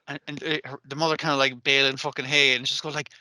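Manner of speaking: ordinary delivery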